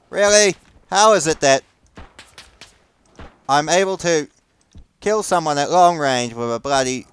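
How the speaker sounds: high, loud incredulous Really; disbelieving at the gun's luck; exasperated disbelief. Real, not acted. Gamer ranting at his revolver missing, exasperated and loud. Frustrated at missed shots.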